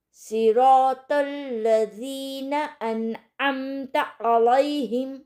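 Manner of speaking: सिरातल लज़ीना अनअमता अलैहिम slow, drawn-out tajweed; slow recitation. Shown as a demonstration. Teacher recites the next verse for practice, slow tajweed. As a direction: recite each word slowly, letters clear. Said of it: "तो चलें".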